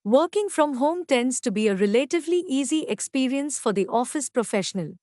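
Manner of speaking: ordinary talk, nothing marked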